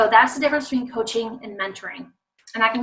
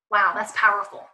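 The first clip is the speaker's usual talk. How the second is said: ordinary talk, nothing marked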